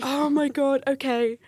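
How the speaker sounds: acted-out relieved exhale. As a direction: let the tension out on the words. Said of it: "this sigh of relief".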